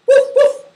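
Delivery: high, loud puppy woofs; two short, high-pitched barks; dog bark. Shown as a demonstration. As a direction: yap like a small dog. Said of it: "I will make the sound".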